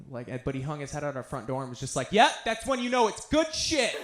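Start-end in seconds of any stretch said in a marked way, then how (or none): 2.1-4.0 much higher and louder, laughing pride; excited approval. Retold or imitated. Dad to vomiting son, gleeful laughing approval. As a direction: crow it like a proud stoner dad.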